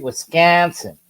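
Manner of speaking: mock Wisconsin accent, flattened "a" in "Wisconsin". Shown as a demonstration. Host checking the pronunciation of Clayton's state, mock Wisconsin accent. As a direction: say "Wisconsin" as "Wis-can-sin", locally accented. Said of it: "did I say that right?".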